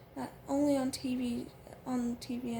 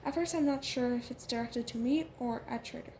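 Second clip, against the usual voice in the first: plain reading voice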